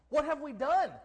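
loud, high cry of dismay; dismayed outcry. Acted. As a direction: throw the question out, aghast. Same thought repeated as an outcry.

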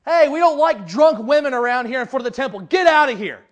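loud mock telling-off; indignant shooing. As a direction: act out a priest ordering someone away. Priest chases off supposed drunk woman.

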